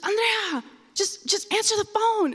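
high insistent plea; urgent mom. Retold or imitated. Mom urging her daughter back to the phone, high and insistent. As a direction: press her to answer, high-pitched.

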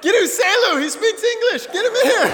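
high, loud hollered order; urgent yell. Retold or imitated. Host mom sends for the English speaker, urgent yelling. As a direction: imitate a frantic mom yelling for help.

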